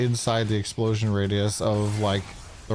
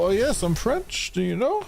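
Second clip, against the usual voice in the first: pitched way up, posing as French; fake French person; 11 semitones higher than usual. Boasting to people, a high put-on pretend French voice. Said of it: "I used to tell people".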